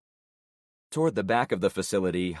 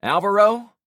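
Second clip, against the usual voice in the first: much higher, louder questioning call; Javon's voice; 12 semitones higher, 10 dB louder than usual. Acted. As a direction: demand an answer. Javon presses, no answer.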